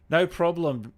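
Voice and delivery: ordinary talk, nothing marked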